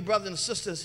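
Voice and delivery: ordinary talk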